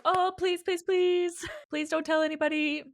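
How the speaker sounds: high, cutesy imitation of begging; cute pleading. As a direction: play-act an adorable high-pitched plea. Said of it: "but, like, really cute".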